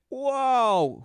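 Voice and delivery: loud high sarcastic wow. Real, not acted. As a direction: fake amazement, loud. Sarcastic exclamation.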